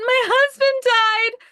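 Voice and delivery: high, loud, slow mock wail; blurting grief at a stranger in Target, mock wail; wailing reply. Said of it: "And I said".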